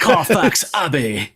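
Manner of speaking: drawn-out, hammed-up fake British accent; mock bad English accent. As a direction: stretch it in an awful English accent. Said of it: "worst accent I've ever heard".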